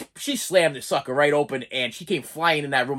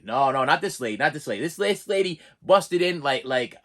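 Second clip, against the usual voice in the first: usual speaking voice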